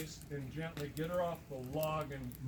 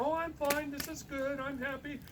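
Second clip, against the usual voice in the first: high-pitched imitation of a woman; woman reassuring tree; 9 semitones higher than usual. Anxious woman talking to the tree again, high mock voice. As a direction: mimic her insisting she's fine, pitched well up. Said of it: "She starts talking to it again".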